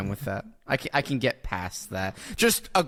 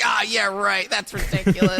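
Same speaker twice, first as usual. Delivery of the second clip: mocking; 7 semitones higher, 4 dB louder than usual. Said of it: "makes fun of him, saying, like".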